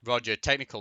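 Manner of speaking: ordinary talk, nothing marked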